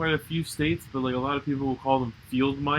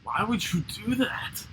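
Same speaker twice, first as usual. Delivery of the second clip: appalled; 7 semitones higher, 4 dB quieter than usual. Real, not acted. Appalled at the feeding story.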